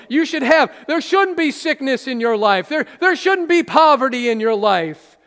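TV prosperity preacher. Retold or imitated. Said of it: "And they talk just like that".

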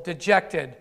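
ordinary talk

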